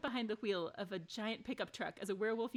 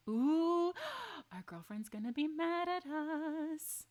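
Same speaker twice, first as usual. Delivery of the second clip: high, slow teasing ooh; drawn out, mock-alarmed; gasping worry; 8 semitones higher, 4 dB louder, slower than usual. Real, not acted. Streamer fears her girlfriend's anger, high and slow.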